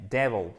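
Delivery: DEVIL stressed on DEV, with short E; first-syllable stress. Shown as a demonstration. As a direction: hit the start of "Devil", weaken the end. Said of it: "Accent tonique ici".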